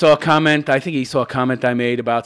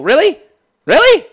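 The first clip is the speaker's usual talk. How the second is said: high, loud disbelieving really; incredulous; 14 semitones higher, 9 dB louder than usual. Real, not acted. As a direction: scoff in disbelief. Incredulous at the quote.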